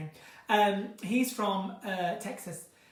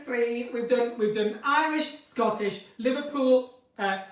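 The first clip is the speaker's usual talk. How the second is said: ordinary talk, nothing marked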